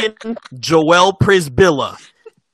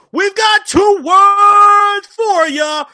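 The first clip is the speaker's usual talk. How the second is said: slow, drawn-out hype shout; 10 semitones higher, 4 dB louder, much slower than usual. Acted. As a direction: belt it out like a crowd chant.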